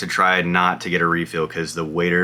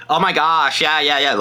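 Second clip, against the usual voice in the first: put-on bubbly valley girl gush, pitched up; California girl voice; 9 semitones higher than usual. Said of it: "the California girl".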